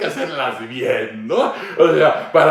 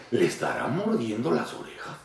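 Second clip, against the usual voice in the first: sly aside; 5 semitones lower, 11 dB quieter than usual. Retold or imitated. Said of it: "Y dices".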